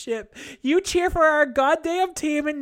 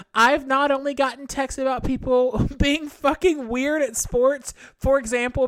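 normal talking voice

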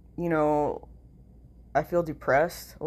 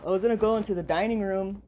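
a bit higher and fast, showing the voice; higher, faster repeat; repeat with the new voice; 5 semitones higher, faster than usual. Shown as a demonstration. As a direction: say it over, higher and quick.

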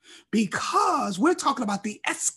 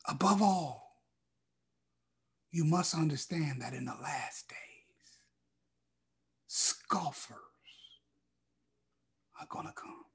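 much softer, nearly a whisper; whispered reading; 5 semitones lower, 9 dB quieter than usual. Shown as a demonstration. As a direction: draw them in with a hush. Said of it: "repeated verse, now whispered".

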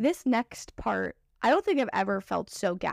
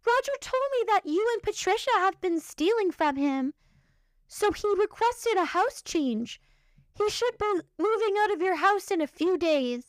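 higher, prim mock voice; higher mock HR lady explaining Roger's story; 8 semitones higher than usual. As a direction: play the condescending HR lady. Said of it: "HR lady explaining".

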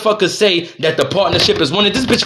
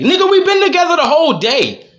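exasperated retort; 8 semitones higher than usual. Retold or imitated. Arguing with his wife over a bag.

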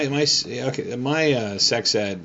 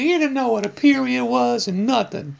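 much higher mocking girly voice; mocking girls' voices; 5 dB louder than usual. Man mimics the girls from the bus, high and mocking. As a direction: squeak it out like teasing schoolgirls. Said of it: "Yeah, they".

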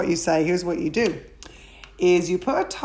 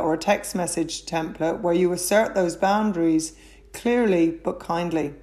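ordinary talk, nothing marked

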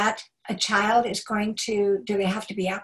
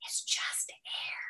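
gentle correcting whisper, slow and airless; slow soft unvoiced whisper to a child; 3 semitones lower, 14 dB quieter, slower than usual. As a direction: coach a child in a true whisper. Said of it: "and you go".

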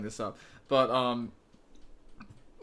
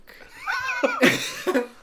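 big laugh. Real, not acted. Laughs at own joke.

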